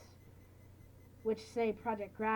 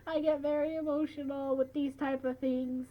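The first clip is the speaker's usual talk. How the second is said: mock weepy reply; 7 semitones higher than usual. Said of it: "And I'm just like".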